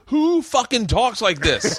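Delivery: slow, high-pitched mock outrage; hammed-up disbelief at the wording; incredulous protest. Imitating actor complaining about a line, incredulous protest. Acting out co-star's protest over script.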